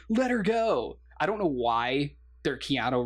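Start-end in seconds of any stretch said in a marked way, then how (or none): 0.1-1.0 put-on whiny teen plea, pitched high; mock teenager. Retelling Indy arguing with his dad, mock teenage plea. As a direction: imitate a teenager pleading with Dad, hammed up. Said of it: "pretending like he's a teenager again".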